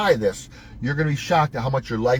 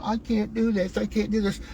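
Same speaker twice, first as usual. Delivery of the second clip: mock whiny voice, higher and softer; a hammed-up whiny complainer; whiner; 6 semitones higher, 4 dB quieter than usual. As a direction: whine it like a quitter giving up. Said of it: "one of them whiners going".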